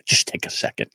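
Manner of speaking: whispered aside. Real, not acted. Comedian apologizing while turning off his phone, whispered. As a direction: breathe it, voiceless, off-mic.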